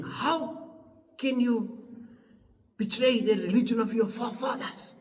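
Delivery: lightly voiced father, incredulous; shocked father. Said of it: "the father was saying".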